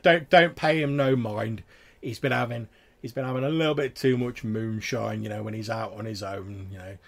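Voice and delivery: lightly voiced weary rustic friend; mildly exasperated village drinker excusing his mate; long-suffering companion. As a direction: wave the tale away as a country local.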